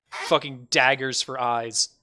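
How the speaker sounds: normal talking voice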